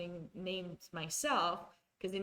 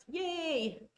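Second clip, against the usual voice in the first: little cheer; 8 semitones higher than usual. Real, not acted. Celebrating saying the name right.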